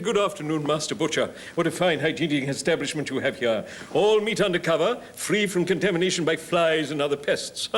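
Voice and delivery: loud, high theatrical declaiming; hammy actor buttering up a shopkeeper; old touring actor. Acted. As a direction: project grandly like an old thespian. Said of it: "a story about an old boy who's on tour".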